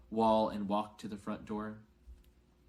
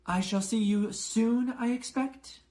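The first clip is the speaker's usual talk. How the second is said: much higher, louder prim goodbye; McGonagall's farewell; 12 semitones higher, 7 dB louder than usual. A narrator's reading. McGonagall bids Dumbledore farewell, higher voice. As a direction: say farewell, lifted and formal. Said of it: "McGonagall's farewell then Dumbledore's".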